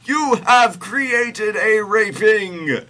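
high, slow full-volume scream; God screaming. A narrator's reading. Host reading God accusing Mary, screaming. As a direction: scream it out, stretching every word. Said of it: "screamed God".

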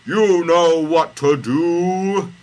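high, loud, drawn-out proclamation; hammed-up godly order; grand command. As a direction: boom it out slowly and high. God commands Moses in story.

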